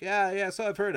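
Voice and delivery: high, loud mock agreement; knowing agreement. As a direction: act out an over-eager agreeing butler. Butler agrees about the wife.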